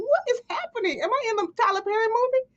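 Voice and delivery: high, fast, bewildered squeak; baffled disbelief. Retold or imitated. Teller asks if she's in a Tyler Perry movie, high and disbelieving.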